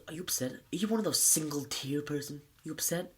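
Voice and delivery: imitating a teacher belittling his pupil; deliberately embarrassing. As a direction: needle him with condescending questions. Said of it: "embarrasses him on purpose".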